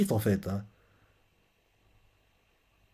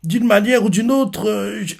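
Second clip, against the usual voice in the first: crying rival; 7 semitones higher than usual. Retold or imitated. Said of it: "Kachan pleure".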